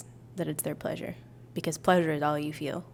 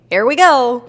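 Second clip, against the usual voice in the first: put-on Irish accent; 6 semitones higher, 14 dB louder, slower than usual. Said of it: "Are you Irish?".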